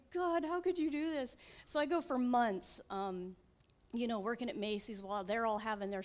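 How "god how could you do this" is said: retold anger at God. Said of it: "I am so mad".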